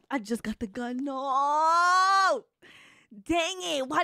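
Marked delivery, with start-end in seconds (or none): 1.0-2.4 long, loud rising wail of no; drawn-out cry of dismay. Real, not acted. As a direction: wail it out in defeat. Loses after getting the gun.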